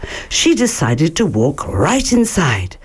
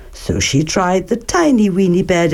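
ordinary delivery

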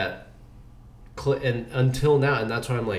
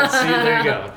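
laughing; 13 semitones higher, 8 dB louder than usual. Real, not acted. Laughing at his own bad accent.

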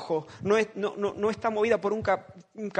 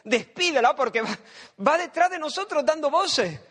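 exasperated disciples; 6 semitones higher than usual. Acted. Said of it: "los discípulos le dicen".

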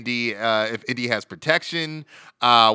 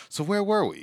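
innocent; 8 semitones higher than usual. Retold or imitated. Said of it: "as if nothing at all is going on".

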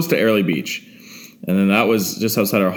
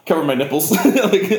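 ordinary delivery

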